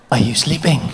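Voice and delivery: low, needy put-on voice; low bedtime wheedling; horny boyfriend in bed. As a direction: imitate a partner pestering a sleeper. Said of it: "this horny little rabbit behind you".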